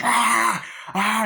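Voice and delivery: loud, high imitated shriek; mock demon scream.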